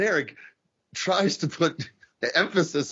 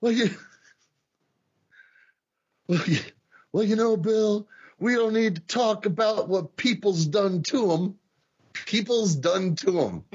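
mock-dim wrestler voice, higher; Kerry impression; 8 semitones higher than usual. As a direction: stumble through it like a punch-drunk wrestler. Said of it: "Kerry says".

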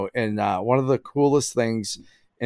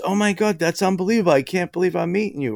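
starstruck fan; 8 semitones higher, faster than usual. Retold or imitated. Fan meets him, starstruck.

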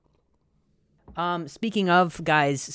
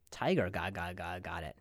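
quiet; 8 semitones lower, 9 dB quieter than usual. Shown as a demonstration. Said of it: "repeats headline quietly".

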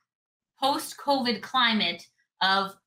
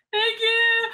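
high, sugary thank you; overly polite thanks, pitched up; mock sweet thanks; 11 semitones higher, 3 dB louder than usual. S0 thanks the rude cantina worker in a high, sugary voice.

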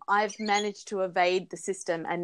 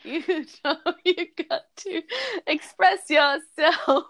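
hammed-up wailing; 8 semitones higher than usual. Sung-out high crying.

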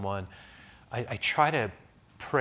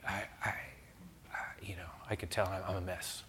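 whispered; 4 semitones lower, 12 dB quieter than usual. Retold or imitated. Continuing the man's whispered confession.

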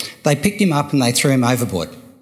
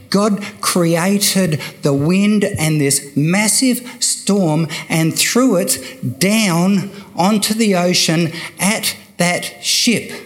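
ordinary talk, nothing marked

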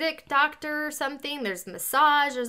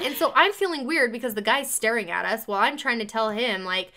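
normal talking voice

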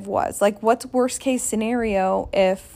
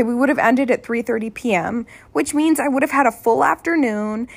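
ordinary delivery

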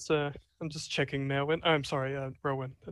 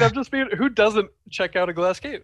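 laughing repeat; 6 semitones higher, 8 dB louder than usual. Shown as a demonstration.

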